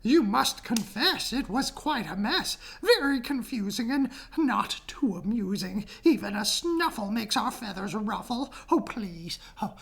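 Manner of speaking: high, fretful bird voice; pleading birds. Acted. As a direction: complain in a high chirpy voice.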